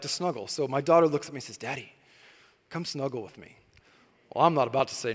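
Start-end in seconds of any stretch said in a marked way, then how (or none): 1.6-3.6 put-on soft, much quieter little-girl plea; hushed child's request; little daughter's voice. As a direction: gently voice a small girl asking for snuggles. Daughter asking at bedtime.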